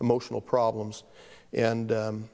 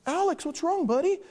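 higher, tender concerned voice; worried teacher. Retold or imitated. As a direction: comfort the boy in tears. Teacher comforting crying boy again.

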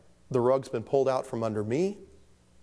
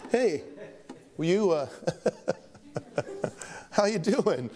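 mock cheery greeting; 6 semitones higher than usual. Greeting a churchgoer hiding by melons.

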